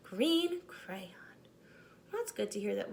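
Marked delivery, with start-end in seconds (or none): 0.1-1.1 breathy whisper of the name; whispered signature. Acted. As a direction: sign off in a whisper.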